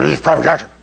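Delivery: growled mock sergeant introduction; drill sergeant impression. Drill sergeant shows recruit to the other sergeants, growled impression. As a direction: run it together in a rough sergeant growl. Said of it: "Kind of one of these kind of guys".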